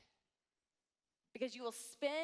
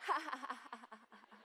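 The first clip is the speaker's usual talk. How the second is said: mock kids' laughter; 4 dB quieter, faster than usual. Mocking a friend's perfect picnic photo, giggling. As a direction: fake giggling.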